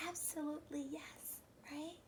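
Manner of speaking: soft unvoiced affirmation; whisper. Teacher whispering to the class. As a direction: whisper it warmly.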